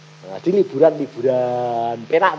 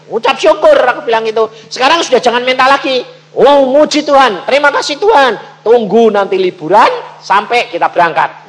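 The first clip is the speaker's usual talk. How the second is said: loud, high, driving sermon telling; preaching at full force; 10 semitones higher, 13 dB louder than usual. Acted. Preacher to congregation, telling of his Australia trip, loud preaching. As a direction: belt the story out to the congregation.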